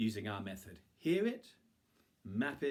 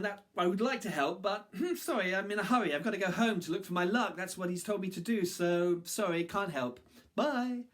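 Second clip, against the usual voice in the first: hurried; 9 semitones higher, 6 dB louder than usual. Acted. Hurried refusal.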